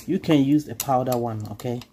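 usual speaking voice

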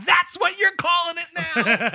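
hammed-up incredulous yell at the professor; mock incredulous shout. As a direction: yell it in disbelief. Mocking the professor.

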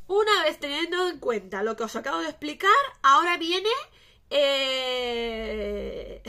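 loud, high announcement building to a held "eh"; hyped build-up. Real, not acted. Vlogger teasing what comes next, loud and hyped.

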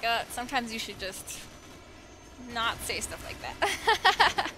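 slow, laughing wry remark; mock lesson, laughed out slowly. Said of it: "it's like".

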